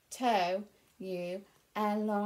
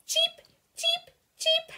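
very high squeaky chick cheeps; cheeping ducklings; 5 dB louder, slower than usual. Acted. Ducklings cheeping in the story, tiny high squeaks. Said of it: "ducklings cheeping".

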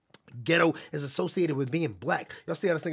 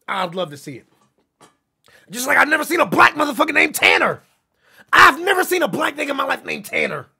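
loud, much higher, insistent questioning; loud challenge; 8 semitones higher, 11 dB louder than usual. Real, not acted. Streamer challenging viewers to answer, loud demanding.

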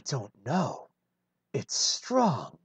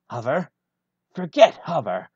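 sudden much louder snap, FORGET punched; loud fed-up retort; exasperated outburst; 8 dB louder, faster than usual. Acted. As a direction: throw the word away in frustration. Frustrated pilot gives up.

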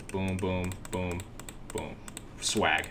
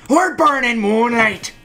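We're burning moonlight called out higher and louder, falling at the end; louder, higher take of We're burning moonlight; louder urging call; 8 semitones higher, 4 dB louder than usual. Shown as a demonstration. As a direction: urge them on, calling We're burning moonlight. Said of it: "repeated louder".

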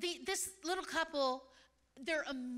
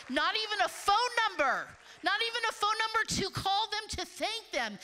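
loud, higher, hammering the phrase home; emphatic preaching; 5 semitones higher, 6 dB louder than usual. Real, not acted. Preacher marveling to congregation, loud emphatic. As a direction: drive it home to the crowd. Preacher stressing givers' humility.

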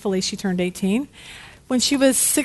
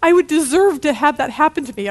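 high mock-tearful outrage; hammed-up upset daughter; imitated sobbing; 4 semitones higher, 3 dB louder than usual. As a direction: protest like a weeping teen, put on. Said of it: "she's crying and sobbing".

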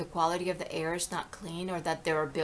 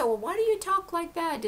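higher imitation of nosy people; questioning critic; 10 semitones higher than usual. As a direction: mimic someone querying your accent. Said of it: "when people say".

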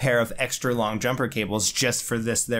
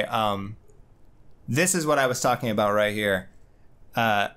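normal talking voice

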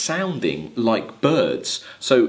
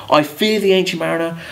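wedding guest voice; 7 semitones higher, 4 dB louder, faster than usual. Acted. Wedding guest voiced in poem reading.

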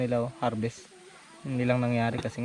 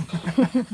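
laugh; 6 semitones higher, 5 dB louder than usual. Real, not acted. Laughing during harvest talk.

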